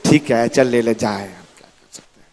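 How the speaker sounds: low, soft defeated giving in; resigned father. Acted. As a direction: sigh and give up like a tired father. Father gives in. (धीमी, हारी हुई पिता की आवाज़)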